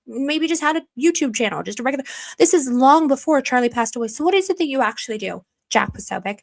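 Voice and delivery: ordinary delivery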